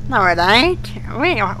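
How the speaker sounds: usual speaking voice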